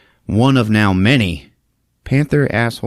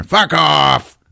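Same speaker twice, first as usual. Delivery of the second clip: growling panther; 6 semitones higher, 4 dB louder than usual. Retold or imitated. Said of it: "He's growling at her".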